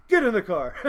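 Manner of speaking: loud, amused call; imitated holler on the edge of laughter; imitating laughing friend. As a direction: call it out as if cracking up. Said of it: "he's, he's laughing".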